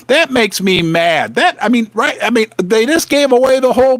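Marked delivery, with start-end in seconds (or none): none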